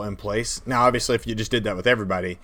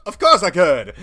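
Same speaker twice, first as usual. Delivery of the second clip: higher and louder, eager; piano player voice; 7 semitones higher, 8 dB louder than usual. Retold or imitated. Piano player answering the players, mock eager. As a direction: play the cheerful piano player. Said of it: "And he's all".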